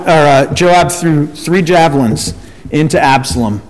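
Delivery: ordinary talk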